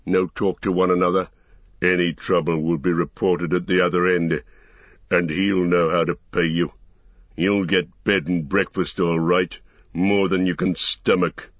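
menacing orc leader voice; gruff orc captain. Acted. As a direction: threaten like a brutal orc captain. Ugluk silences the prisoners.

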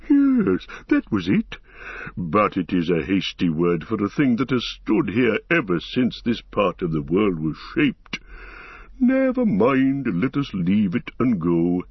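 deliberate, weighty forest-giant voice; Treebeard, recollecting. Acted.

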